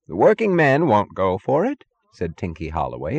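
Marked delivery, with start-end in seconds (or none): 0.1-1.8 higher, louder eager suggestion; more helpful. A narrator's reading. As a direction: chime in to be of help. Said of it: "a bit more helpfully".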